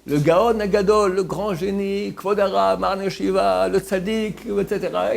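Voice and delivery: loud, high emcee praise; booming wedding MC listing titles; announcer at the mic. Retold or imitated. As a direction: hail the rabbi like a wedding announcer. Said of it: "quelqu'un au micro, il dit".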